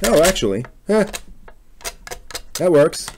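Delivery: the sanding worked, and you didn't expect it to; pleasantly surprised. Real, not acted. Maker tells the viewers the sandpaper worked, pleasantly surprised.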